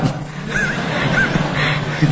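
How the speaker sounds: high breathless laugh; burst of laughter. Real, not acted. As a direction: laugh out at the absurd message. Laughs at the message.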